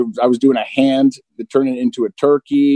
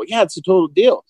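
higher-pitched mock-agreeable voice; mirror's reply; 7 semitones higher than usual. Mirror sealing the pact with the man, mock-agreeable. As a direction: seal the deal as the reflection. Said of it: "the mirror would go".

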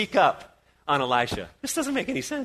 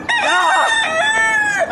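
very high, loud shriek; startled scream; 5 dB louder than usual. Acted. Servant wakes, sees army.

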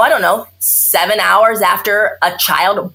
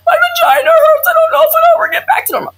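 high, loud, half-crying wail; tearful new mom; 5 dB louder than usual. Retold or imitated. Doctor acting out a weepy postpartum patient, loud tearful wail. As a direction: wail it like tears are breaking through. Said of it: "That's baby blues".

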